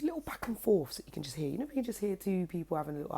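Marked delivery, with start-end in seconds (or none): none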